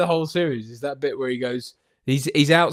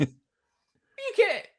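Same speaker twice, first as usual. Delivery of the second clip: squeaky quote. Retold or imitated. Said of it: "And he just goes".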